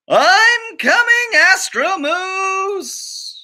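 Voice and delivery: high, slow stretched heroic call; heroic cry. Acted. Chipmunk rushing to rescue the moose, high heroic call. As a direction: sing it out like a cartoon hero.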